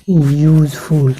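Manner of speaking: Useful drawn out slowly, yoo-sound first; teacher slowly sounding out useful; slow model word. Shown as a demonstration. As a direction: model useful carefully for students. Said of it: "इसलिए यहाँ पे L होगा".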